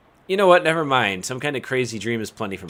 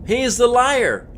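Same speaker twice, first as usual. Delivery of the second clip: much higher, slower insistent tree voice; shrill accusing tree; 12 semitones higher, 5 dB louder, slower than usual. Acted. Tree accusing its brother, high and slow. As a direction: point the finger as a squeaky tree.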